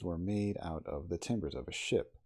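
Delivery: usual speaking voice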